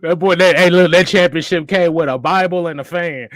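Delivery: loud, laughing mockery; loud roasting laugh. Real, not acted. Co-host mocks the championship, loud and laughing. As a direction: crack up loudly while roasting the title.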